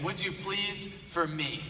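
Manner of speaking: quiet, slow begging, almost hushed; a teacher pleading softly with a failing student. Retold or imitated. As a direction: coax him gently, just for me. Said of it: "she begged him and begged him".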